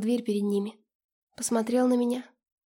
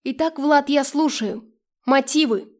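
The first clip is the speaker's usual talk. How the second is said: raised, louder and higher, demanding answers; growled demand; 3 semitones higher, 7 dB louder, slower than usual. A narrator's reading. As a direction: growl it out like an interrogation. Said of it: "и прорычала". (требовательный рык, громко и с напором)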